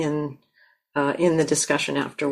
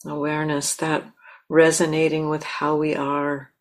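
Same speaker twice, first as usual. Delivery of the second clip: guided meditation. Acted. Teacher guiding the listeners to land in themselves, calm and unhurried. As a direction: gently lead them into stillness.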